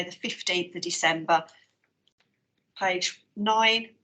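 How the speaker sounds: usual speaking voice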